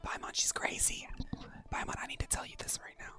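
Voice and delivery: fast hushed whisper; urgent whisper. Real, not acted. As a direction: rush it out under your breath. Urgent aside to Paimon.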